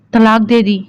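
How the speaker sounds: ordinary delivery, nothing marked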